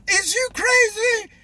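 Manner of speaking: high, drawn-out street incredulity; neighborhood-style indignation. Acted. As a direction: stretch it out high and outraged. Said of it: "Like in my neighborhood".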